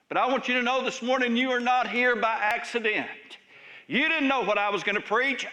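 ordinary delivery

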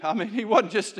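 ordinary talk, nothing marked

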